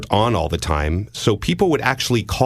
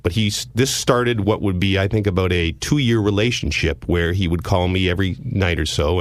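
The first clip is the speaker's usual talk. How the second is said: normal talking voice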